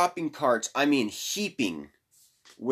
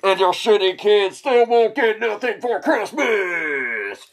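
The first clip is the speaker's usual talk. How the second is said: mock yell; 6 semitones higher, 5 dB louder than usual. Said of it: "I'm gonna start yelling".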